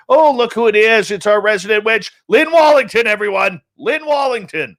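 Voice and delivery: high, loud, excited name call; excited announcement. Real, not acted. Host spotting a regular in the chat, excited announcement.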